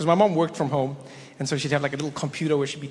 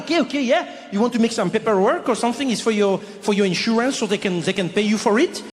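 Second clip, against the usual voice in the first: put-on higher-pitched, easygoing French accent; cool French guy; 6 semitones higher than usual. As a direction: offer help casually as a French clerk. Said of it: "very French, very French, cool guy".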